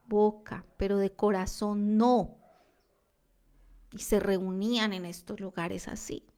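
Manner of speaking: ordinary delivery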